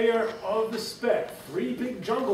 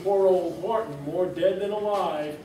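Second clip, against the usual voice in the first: usual speaking voice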